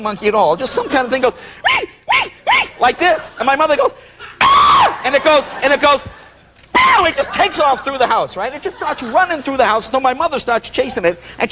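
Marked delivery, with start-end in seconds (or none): 4.4-5.0 high, loud imitated shriek; mother's mock scream. Telling how mother met the monkey, imitated high scream. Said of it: "my mother is just screaming". 6.7-7.0 high, loud "pow" burst; explosive sound effect. Retold or imitated. Telling how the monkey took off, explosive imitated pow.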